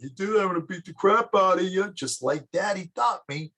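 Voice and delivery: a schoolboy bully echoing Daddy's menace; child copying dad. Acted. Boy threatening a classmate the way his father did.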